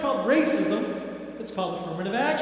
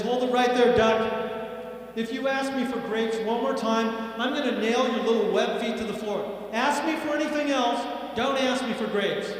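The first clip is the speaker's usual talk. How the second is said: louder, raised-pitch fed-up manager; mock angry threat; 4 semitones higher, 4 dB louder than usual. Manager threatens a pestering duck, mock angry. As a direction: lay down the law, exasperated.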